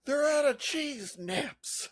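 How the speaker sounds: high, slow anguished cry; mock despair. Co-host voicing the screaming shopper, high drawn-out mock anguish. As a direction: wail over a trivial loss.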